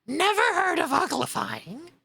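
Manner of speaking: high and loud, astonished; exclaiming. Acted. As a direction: burst out in disbelief. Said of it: "it exclaimed".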